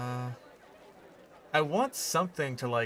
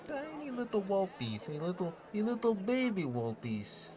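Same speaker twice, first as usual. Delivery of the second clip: higher-pitched, hammed-up Russian accent; terrible Russian accent; 5 semitones higher, 3 dB quieter than usual. Grumbling about a wall piece that's too big, in a hammed-up Russian accent. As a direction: play a gruff Russian builder, badly faked Russian accent. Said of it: "my terrible Russian accent".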